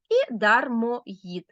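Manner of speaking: і дармоїд said very slowly; slow down to close the list with і дармоїд; slow last answer. Shown as a demonstration. Teacher checking the answers aloud, slowly. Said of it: "А тепер перевіряємо".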